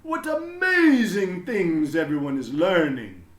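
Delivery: a beaming principal proclaiming to everyone; proud headmaster announcing. A narrator's reading. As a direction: announce it grandly to the whole school. Headmaster voice continues.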